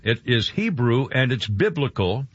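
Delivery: ordinary talk, nothing marked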